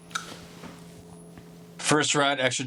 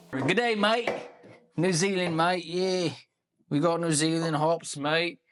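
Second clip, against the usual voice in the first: put-on higher, playful New Zealand accent; hammed-up New Zealand accent; mock Kiwi accent; 5 semitones higher than usual. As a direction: put on a jokey Kiwi "g'day mate".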